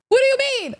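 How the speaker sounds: high, disbelieving question; incredulous. Real, not acted. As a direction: squeak it in disbelief. Incredulous reaction.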